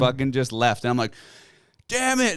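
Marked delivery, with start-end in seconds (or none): 1.9-2.4 frustrated curse. Retold or imitated. Buck runs off after call.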